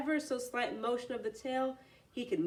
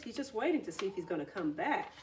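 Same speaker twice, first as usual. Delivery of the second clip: plain reading voice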